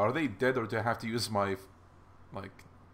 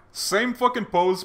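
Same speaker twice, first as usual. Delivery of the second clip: sarcastic; 8 semitones higher, 5 dB louder, slower than usual. Real, not acted. Mocking lazy card art.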